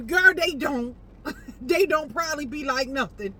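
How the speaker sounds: telling herself off at full voice; loud self-correction. Real, not acted. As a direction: snap yourself out of it, loudly. Scolding herself for imagining.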